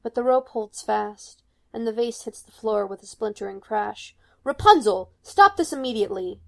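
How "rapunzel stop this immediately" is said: loud, furious order; loud command. Acted. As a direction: shout her down in panic. Mother commands, rope at her neck.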